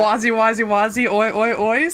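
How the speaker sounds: hammed-up Australian "oi oi oi"; mock Australian accent. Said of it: "Do we have to say it with an Australian accent?".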